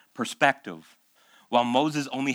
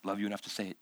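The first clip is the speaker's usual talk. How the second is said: ordinary delivery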